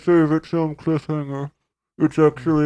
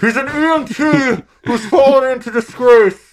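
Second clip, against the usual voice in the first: character voice; 8 semitones higher, 4 dB louder than usual. Acted. Guest voice, higher and louder.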